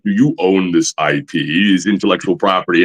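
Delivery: low, probing villain voice; imitated deep, businesslike interrogation; Cary Tagawa impression. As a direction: drop low and question gravely. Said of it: "he's got that voice".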